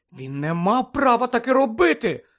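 loud, high burst of indignant shock. A narrator's reading. Friend reacts to a judge offering Harry help, shocked. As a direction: exclaim it in disbelief, voice jumping up. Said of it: "вражено мовила Герміона".

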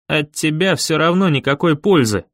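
usual speaking voice

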